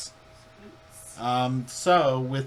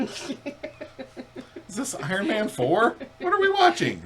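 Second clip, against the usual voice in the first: much higher, laughing squeak; mock confused heroes; 12 semitones higher, 5 dB louder, slower than usual. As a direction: ham up the baffled Avengers. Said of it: "They're like".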